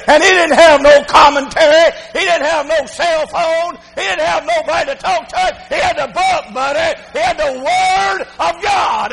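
high-pitched, emphatic sermon cadence; slowing, weighty build to the last phrase; fervent preaching. Real, not acted. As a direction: drive each point home from the pulpit. Preaches at full force.

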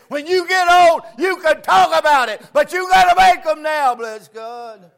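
put-on loud, high, shouted sermon to the young people; preaching at full force. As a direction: drive the point home to the young people.

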